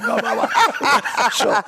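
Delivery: high, slow babble through laughter; laughing. Real, not acted. Comic cracking up over his toga story, helpless laughing. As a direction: crack up while trailing into nonsense.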